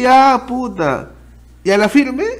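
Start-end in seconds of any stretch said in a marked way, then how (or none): 0.0-1.1 drawn-out resigned sigh. Retold or imitated. Exasperated reaction in story.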